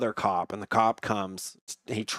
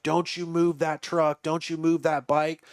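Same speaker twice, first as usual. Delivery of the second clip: higher-pitched imitation of an insistent cop; mock-stern command, pitched up; retold warning; 6 semitones higher than usual. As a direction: lightly act out a cop laying down orders. Retold cop warning during standoff.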